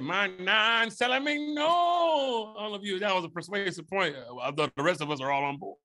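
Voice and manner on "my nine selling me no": drawn-out, high replay of his own line; slow quote. Retold or imitated. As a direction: stretch it out slowly and higher. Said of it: "when I said".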